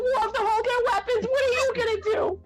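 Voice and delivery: high, fussy mother's voice; fretting mom. Acted. Mother grilling son about helping people, high fretting. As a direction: nag him like a worried mother.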